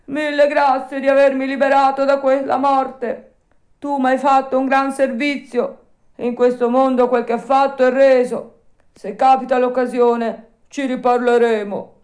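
high, loud heartfelt thanks; grateful dog. A narrator's reading. Alidoro thanking Pinocchio for saving him, high and loud. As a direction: thank your rescuer warmly and loudly. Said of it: "rispose il cane".

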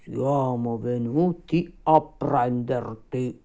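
slow, ominous creature voice; grim rabbit undertaker. Acted. Rabbit come to fetch the dying puppet, ominous. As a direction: announce doom very slowly.

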